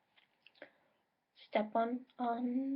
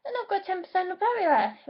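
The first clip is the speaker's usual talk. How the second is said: alarmed; 9 semitones higher, 9 dB louder, much faster than usual. Real, not acted. Notices low laptop battery.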